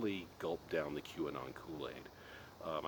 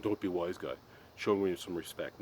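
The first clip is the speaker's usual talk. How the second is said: mock scolding dad; 2 semitones higher, 6 dB louder than usual. Mocking his dad's scolding.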